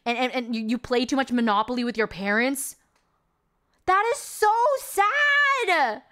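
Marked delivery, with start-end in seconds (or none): 3.8-6.0 high, slow, sarcastic sad; mock pity. Real, not acted. Reviewer mocking the bullied girl's lines, high and slow. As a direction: drag it out with fake sympathy.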